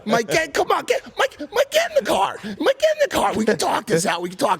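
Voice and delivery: very high, strained imitation of pleading; urgent coaxing. Imitating a friend begging him into the car, high urgent pleading. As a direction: plead repeatedly in a very high mock voice.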